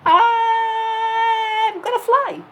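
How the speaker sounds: put-on high, slow triumphant kid voice; a little boy declaring he'll fly, arms spread; child hero shouting. A narrator's reading. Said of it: "and he said".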